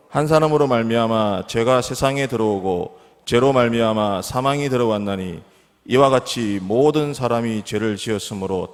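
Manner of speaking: loud, measured congregational Bible reading; scripture reading. Acted. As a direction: lead the congregation in reading the verse, firmly and evenly. Leads congregational scripture reading aloud.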